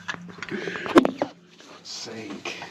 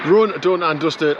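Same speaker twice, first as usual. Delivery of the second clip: drawn-out catchphrase; 5 semitones higher, 4 dB louder, slower than usual. Acted. Catchphrase opening the run recap.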